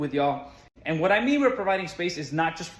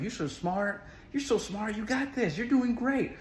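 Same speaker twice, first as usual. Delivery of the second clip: put-on high, softened cheerleading for a child; lifted, encouraging adult-to-child praise; gentle encouragement; 7 semitones higher than usual. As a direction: build a kid up sweetly. Retold encouraging child during test.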